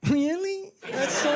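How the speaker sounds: high, gushing fake-thrilled reply; gushing mock reply. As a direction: gush like a flattered friend. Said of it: "no, I didn't say that".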